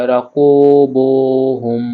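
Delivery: "কু" and "বু" held long; one-alif stretch. Shown as a demonstration. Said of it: "এক আলিফ টেনে পড়তে হয়". ("কু" আর "বু" এক আলিফ টেনে)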